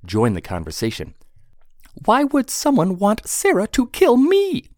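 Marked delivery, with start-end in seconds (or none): 2.0-4.7 high, loud squeaky pixie. Acted. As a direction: squeal it, alarmed little pixie. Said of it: "John, the pixie".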